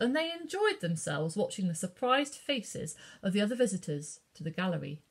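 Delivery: usual speaking voice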